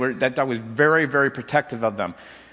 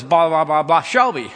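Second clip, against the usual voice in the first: mock yelling; 6 dB louder than usual. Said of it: "I would kinda yell at it".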